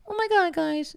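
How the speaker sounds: put-on high excited girl voice; girly friend voice. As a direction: squeal it like a bubbly girl. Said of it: "I'm imitating her".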